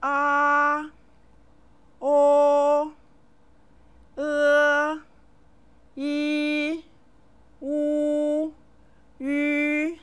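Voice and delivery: each vowel held high and steady, drawn out; model vowel sounds. Shown as a demonstration. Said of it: "let's say the vowel sounds together".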